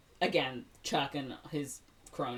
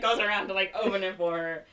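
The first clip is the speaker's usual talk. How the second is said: ordinary talk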